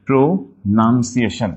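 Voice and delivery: very slow "pronunciation" as pro, nun, ciation; syllable by syllable. Shown as a demonstration. Teacher correcting class, "pronunciation" slowly split around nun. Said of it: "not noun".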